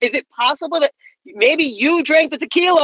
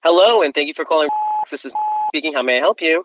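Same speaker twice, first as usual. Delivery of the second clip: low, polite phone agent; call center agent voice; 9 semitones lower than usual. Acted. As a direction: answer like a customer service rep.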